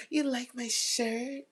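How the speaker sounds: high giggle then slow teasing question; coy flirty giggle. Retold or imitated. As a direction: giggle and play coy about the compliment. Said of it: "We're gonna be like".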